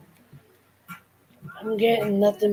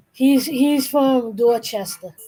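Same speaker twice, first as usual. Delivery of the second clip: drawn-out Boston accent, dropped R's; slower than usual. Shown as a demonstration. Showing off their own accent to a friend, Boston accent. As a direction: lay on the Boston accent slowly. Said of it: "there's my Boston accent".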